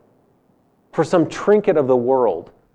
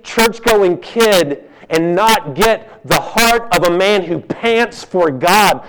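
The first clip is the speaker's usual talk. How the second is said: ordinary delivery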